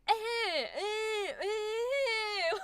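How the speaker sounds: squeamish, unwilling "eh"; reluctant groan. Shown as a demonstration. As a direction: recoil as if you don't want it. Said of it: "like you don't want this".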